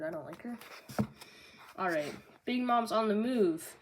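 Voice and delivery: ordinary talk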